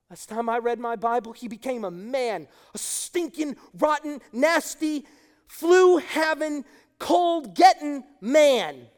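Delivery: starts plain, builds to a loud slow shout on the last words; preacher's crescendo to a loud drawn-out finish; building refrain. Real, not acted. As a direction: climb from plain talk to a slow, loud, punched list.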